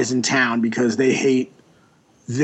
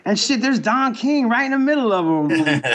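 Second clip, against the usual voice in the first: higher, punchline delivered in character; joke punchline voice; 7 semitones higher than usual. Retold or imitated. Grandpa's joke husband spotting Don King, higher punchline voice. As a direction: toss off the punchline up high.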